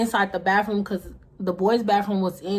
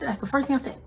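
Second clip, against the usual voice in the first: ordinary delivery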